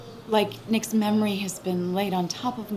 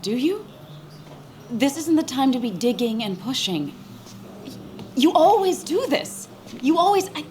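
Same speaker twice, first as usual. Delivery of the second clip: raised, high scolding; angry outburst; 6 semitones higher, 5 dB louder than usual. Acted. Friend at the wake scolds Sam angrily. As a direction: snap at him, hurt and fed up.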